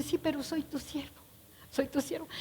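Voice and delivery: very soft, barely-there servant's reply; almost too afraid to speak; timid and humble. Acted. Mefiboset answering the king, very soft and timid. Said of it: "el tono de voz de ... Mefiboset".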